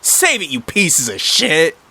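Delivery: high, drawn-out mild yell; acted brash dismissal. A narrator's reading. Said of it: "yelled Potty Mouth Martha".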